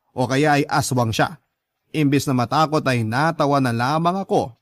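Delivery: normal talking voice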